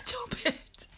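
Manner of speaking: high breathy mock plea, half laughing; imitating stop it. Mimicking her sister's nervous cliff-edge plea, laughing. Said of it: "What did I say?".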